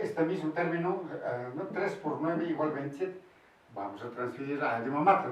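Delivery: ordinary talk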